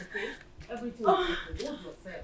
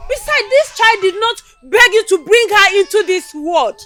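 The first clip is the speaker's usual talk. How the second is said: much louder, higher crying rebuke; tearful furious scolding; 12 semitones higher, 12 dB louder than usual. Acted. Mother to daughter about the neglected baby, a loud tearful rebuke. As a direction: shout her down through tears.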